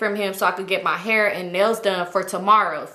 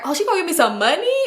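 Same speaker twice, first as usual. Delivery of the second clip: higher, quick mock-eager voice; mock surprised thought; 6 semitones higher, faster than usual. Teller mocking her own thought about free money, mock-eager. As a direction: act out your own greedy surprise.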